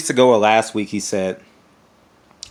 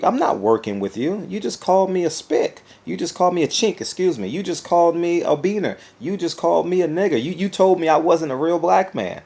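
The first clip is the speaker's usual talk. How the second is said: higher indignant retort, lightly voiced; 7 semitones higher than usual. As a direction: list his insults back at him. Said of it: "look at you like".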